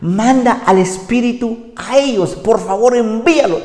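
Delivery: Jesus begging the Father to send the Spirit; Jesus pleading. Retold or imitated. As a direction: beg the Father tenderly for the Spirit. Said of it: "y le dice".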